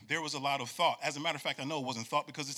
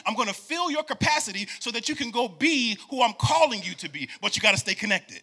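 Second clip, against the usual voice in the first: loud, higher preacher voice as God's promise; preaching God's promise; 7 semitones higher, 7 dB louder than usual. Acted. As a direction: proclaim it like God speaking. Voicing God mid-sermon.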